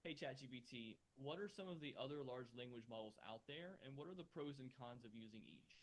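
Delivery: muffled question from far away. Real, not acted. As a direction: sounds muffled, as if through a wall. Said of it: "now it sounds like you're very muffled".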